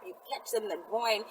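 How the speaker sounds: ordinary talk, nothing marked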